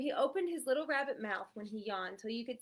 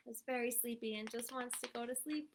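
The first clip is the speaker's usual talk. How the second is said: ordinary delivery, nothing marked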